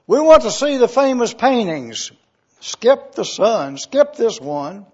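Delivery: loud impatient heckle from the back, easing off; shouted demand. Acted. Bidder in the crowd heckles the auctioneer, loud impatient shout. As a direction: call out from the back of the room, demanding. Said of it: "a voice in the back of the room shouted".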